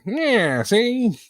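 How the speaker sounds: high, cartoonish wise-guy mimicry; high, hammed-up old-timey voice; old-timey gangster voice.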